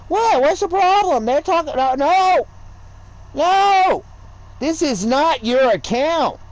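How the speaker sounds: high, loud protesting shout; reenacted argument yell. Arguing with her over his account, loud high protest. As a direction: cry out in exasperated protest.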